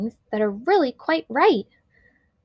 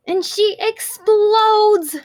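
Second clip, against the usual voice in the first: high, loud and stretched very slow; exploding; 9 semitones higher, 6 dB louder, much slower than usual. A narrator's reading. Narrator hitting the girl's meltdown, high, loud and slow. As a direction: let the word blow up, drawn out.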